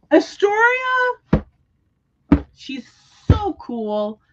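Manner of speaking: high, drawn-out, slow swoon over the name; gushing. Real, not acted. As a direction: stretch the name out like a fangirl. Gushing over favourite character.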